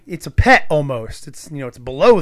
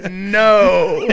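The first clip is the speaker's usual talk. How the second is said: drawn-out, louder mock rejection; acted refusal; 4 semitones higher, 5 dB louder than usual. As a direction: turn down a creepy advance, hammed up. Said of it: "then they're just like".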